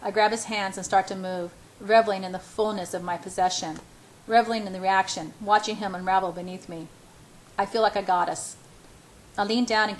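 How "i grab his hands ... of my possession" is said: plain reading voice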